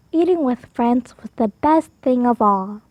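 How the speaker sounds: ordinary delivery, nothing marked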